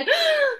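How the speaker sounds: a high, falling gasp of shock; child's shocked gasp. Acted. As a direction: gasp before the big announcement. Trixie bursts into parents' room.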